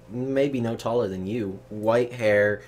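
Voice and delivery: ordinary delivery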